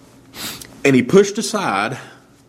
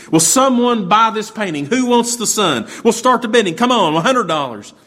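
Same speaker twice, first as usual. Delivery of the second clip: auctioneer calling out; 7 semitones higher than usual. Retold or imitated. Auctioneer pressing for bids.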